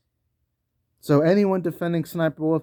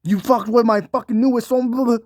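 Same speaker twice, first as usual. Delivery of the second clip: higher, louder confrontational mock; 8 semitones higher, 4 dB louder than usual. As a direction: confront the critic in a hyped-up put-on voice. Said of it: "[name] going to your house and being like".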